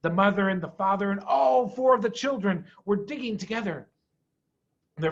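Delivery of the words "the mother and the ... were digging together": plain reading voice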